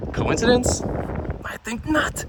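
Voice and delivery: mock ominous, pitched high; mock dramatic. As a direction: play it like a fake conspiracy reveal. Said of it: "No, I'm just kidding".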